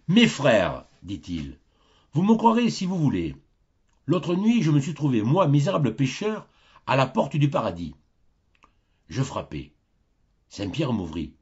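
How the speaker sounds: voix de prédicateur, plus haute et plus forte; prêcher à ses fidèles avec emphase; sermon en chaire. Acted. (le curé Martin prêche à ses paroissiens, voix de sermon)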